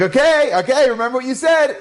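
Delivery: high-pitched, acted-out crowing; triumphant crowing. Dave high-fiving people after winning his bet, triumphant. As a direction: crow over the win to everyone.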